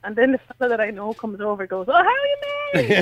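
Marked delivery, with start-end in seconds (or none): 1.9-3.0 high-pitched, gushing hello; effusive mock greeting. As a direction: imitate a friend greeting her across a club. Acting acquaintance's loud friendly greeting.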